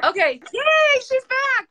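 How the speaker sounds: loud, drawn-out, high-pitched yay; excited cheer. Real, not acted. Host welcoming the dropped guest back to the live, excited cheer. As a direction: cheer with delight and relief.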